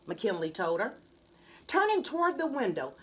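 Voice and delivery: usual speaking voice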